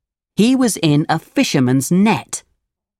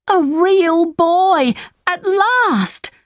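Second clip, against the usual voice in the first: much higher, slow, full of wonder; overjoyed puppet boy; much slower than usual. Acted. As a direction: savor the dream come true. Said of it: "said Pinocchio".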